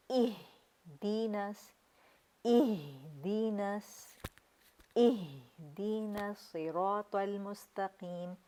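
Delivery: اہدینس stretched very slowly with a soft ha; soft chest ha. Shown as a demonstration. Teacher demonstrating a soft ha from the chest, slowly. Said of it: "جھٹکا نہیں دینا".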